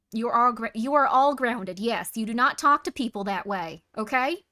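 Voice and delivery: loud, hammed-up parental scolding; mock grounding mother. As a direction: punish the kids, mom style. Pretend mother grounding the chat.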